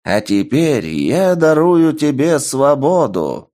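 slow, booming call to the arena; booming proclamation. Acted. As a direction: proclaim to the whole crowd. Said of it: "Голос архижреца ... разнёсся по арене".

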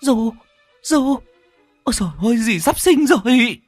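high-pitched cry of alarm; panicked cry, stretching out the last words; horrified. Acted. First wife sees second wife about to give birth, horrified. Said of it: "Bà cả Hoa kinh hoàng".

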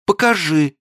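very high moaning request. A narrator's reading. Li moans to see the pearls. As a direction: moan the word. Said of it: "простонала Ли".

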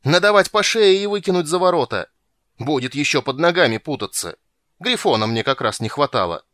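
loud, high outburst easing into angry grumbling; squealing rage. A narrator's reading. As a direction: rant like a king out of patience. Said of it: "завизжал король". (визгливо и гневно)

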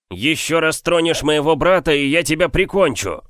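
loud high threatening shout; threat. Acted. Young man threatens the giant over his brother, loud angry shout. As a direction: warn him you'll kill him.

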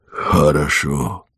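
slow, low, fading reply; weak dying voice. A narrator's reading. Dying man answers Varvara's farewell, weakly. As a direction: answer feebly from a deathbed.

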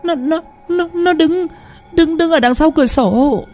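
frightened wife insisting with her face buried; insistent, terrified. Acted. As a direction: force the words out though scared stiff. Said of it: "mà nhấn mạnh".

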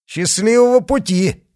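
high, loud cheer; shouted farewell. A narrator's reading. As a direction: call it out high to a passing carriage. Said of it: "крикнули они".

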